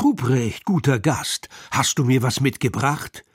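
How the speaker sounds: ordinary delivery, nothing marked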